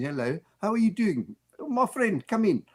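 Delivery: put-on odd guy with a South African accent.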